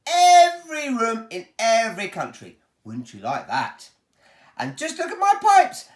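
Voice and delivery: high, loud showman boasting; a proud host showing off his pipes; Willy Wonka voice. Acted. Wonka booms to the children about his pipes, showman voice.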